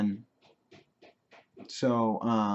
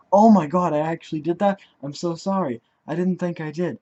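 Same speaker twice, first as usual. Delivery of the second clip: higher-pitched retold horrified realization; shocked apology; 5 semitones higher, 6 dB louder than usual. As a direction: imitate a friend appalled at what they did.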